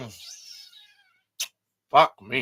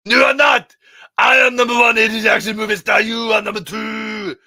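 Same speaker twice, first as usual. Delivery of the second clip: much louder, higher Arnold bark; Schwarzenegger impression; 10 semitones higher, 12 dB louder than usual. Schwarzenegger scolding Stallone on the phone, loud Arnold voice. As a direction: shout at him like Arnold Schwarzenegger. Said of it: "and Schwarzenegger".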